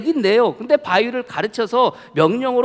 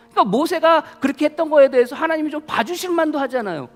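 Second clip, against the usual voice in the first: pleading sermon push; 8 semitones higher, 5 dB louder than usual. Real, not acted. Preacher pleading on Moses' behalf.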